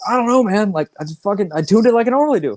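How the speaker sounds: high, put-on defensive roadie voice. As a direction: whine it like a shrugging roadie. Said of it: "he's like".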